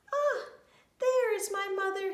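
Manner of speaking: high, loud joyful cry; delighted high-pitched exclamation; excited little hedgehog. A narrator's reading. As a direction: burst out with relief at seeing mother. Lost hedgehog spots his mother.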